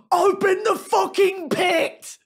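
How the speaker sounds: high slow shouted stage command; hammed-up yell at the crowd; mock yell. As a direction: bellow it at the crowd like a frontman. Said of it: "they'll yell at the crowd".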